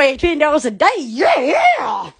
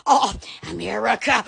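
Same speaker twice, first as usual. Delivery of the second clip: ordinary talk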